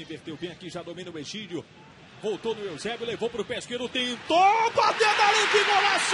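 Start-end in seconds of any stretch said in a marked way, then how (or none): 3.9-6.1 rising to an all-out goal scream; explosive goal commentary. Real, not acted. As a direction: yell the goal at the top of your lungs. Goal scored, commentator erupts.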